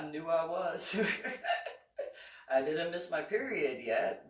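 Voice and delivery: high, loud, laughing confession; laughing at her own certainty; giggling through the words. Real, not acted. Laughing about knowing pregnancy.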